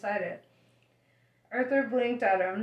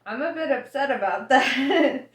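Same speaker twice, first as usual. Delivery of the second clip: laughing through it; 4 semitones higher, 7 dB louder than usual. Acted. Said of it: "said Arthur".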